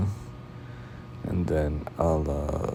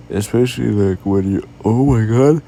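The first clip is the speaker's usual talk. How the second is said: loud yawn overtaking the sentence; rising, loud yawned exclamation; 5 semitones higher, 9 dB louder than usual. Real, not acted. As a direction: start talking, get swallowed by a yawn. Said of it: "I can't stop yawning".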